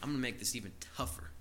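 hushed quick realization; quiet scheming idea. Retold or imitated. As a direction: plot it privately under your breath. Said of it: "Elijah's like".

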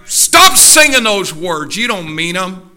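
high, full-voiced shout easing at the end; furious preacher's yell; exasperated scream. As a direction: scream down at a hypocritical church. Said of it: "God doesn't just want to scream".

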